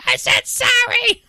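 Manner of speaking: high, slow squeaky replay of her; squeaky imitation. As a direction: squeak it like a silly clip. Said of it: "I'm gonna clip that audio".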